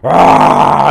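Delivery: short loud roar like breathing fire; mock roar. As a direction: growl out a fiery rah. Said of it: "and breathing fire".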